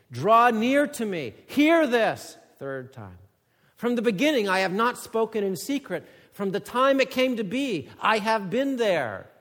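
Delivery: loud, high preaching voice; forceful scripture reading. Acted. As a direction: read God's words like a summons. Reads verse sixteen.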